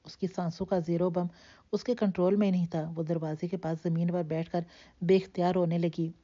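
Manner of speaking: ordinary delivery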